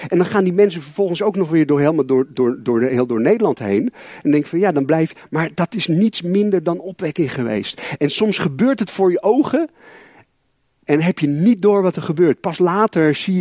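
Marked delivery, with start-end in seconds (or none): none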